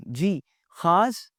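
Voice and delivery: normal talking voice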